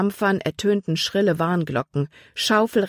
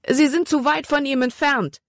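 much higher, fearful outcry; terrified; 9 semitones higher than usual. A narrator's reading. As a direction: despair as the boat lags behind. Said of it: "Calebs Stimme war schreckerfüllt". (erschrockener Ausruf, viel höher)

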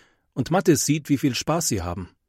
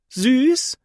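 much higher, loud disbelieving echo; aghast surprise; 6 dB louder than usual. A narrator's reading. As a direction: recoil at the word in disbelief. Saleswoman aghast at cute mouse. (entsetzt und ungläubig, viel höher)

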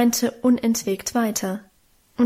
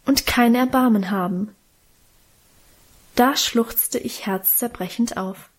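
ordinary delivery, nothing marked

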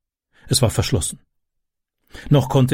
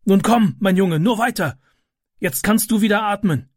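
encouraging man; 8 semitones higher than usual. A narrator's reading. Man urging boy out.

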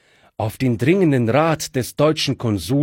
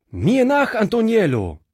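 rallying cry; 9 semitones higher than usual. Acted. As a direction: charge and call the servant after you. Fritz charges, calls servant.

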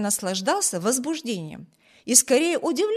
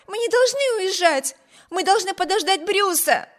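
much higher, pleading cry; alarmed pleading; 10 semitones higher, 4 dB louder than usual. A narrator's reading. Amy begging Wibert to wait for Bruce, alarmed plea. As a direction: beg him in alarm to wait. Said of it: "воскликнула она".

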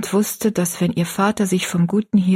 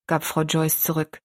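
usual speaking voice

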